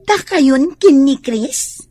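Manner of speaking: loud, slow demanding question; loud slow demand about Chris; sharp loud challenge. Acted. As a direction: call her out, loud and slow. Mother confronts about Chris.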